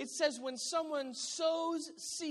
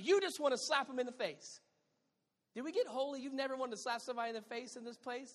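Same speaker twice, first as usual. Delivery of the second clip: usual speaking voice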